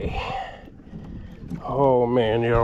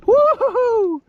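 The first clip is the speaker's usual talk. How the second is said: high, louder woo-hoo cheer; whooping celebration; 4 dB louder, slower than usual. Real, not acted. Angler celebrating a monster blue cat, whooping cheer. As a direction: cheer the victory out loud.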